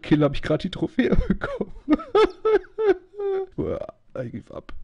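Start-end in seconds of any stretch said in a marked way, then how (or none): 3.6-4.7 soft, low sigh of defeat; defeated groan. Real, not acted. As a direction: give up, drained. Defeated after dying.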